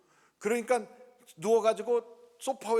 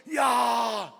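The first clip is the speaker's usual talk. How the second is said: louder, surprised outcry; amazed exclamation; 3 semitones higher, 6 dB louder, much slower than usual. Real, not acted. Pastor amazed by the deacon's gratitude, exclaiming loudly. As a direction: exclaim in real astonishment. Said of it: "깜짝 놀랐어요".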